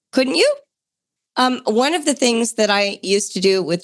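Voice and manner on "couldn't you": higher insistent repeat. Acted. Host repeating her question to listeners, higher and eager.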